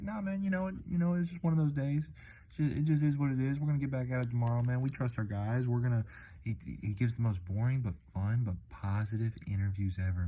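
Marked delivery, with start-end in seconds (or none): 0.0-6.0 higher, laid-back imitation of a soothing coach; calm manager impression. Vlogger imitating the Braves manager's calm postgame interview voice. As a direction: play the unbothered manager shrugging off a loss, pitched up. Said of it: "This guy is the most calm, chill, cool, collected".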